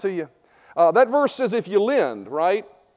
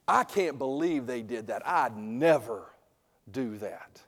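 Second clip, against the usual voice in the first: mock self-righteous gossip; 9 semitones lower, 11 dB quieter, slower than usual. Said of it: "How many ever said that before".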